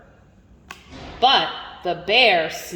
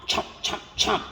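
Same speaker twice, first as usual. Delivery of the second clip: chomp, chomp whispered, breathy and soft; 11 semitones higher, 7 dB quieter, much faster than usual. A narrator's reading. Reading to a child, a whispered chomp. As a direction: hush the chewing down to a whisper. Munching quieter and quieter.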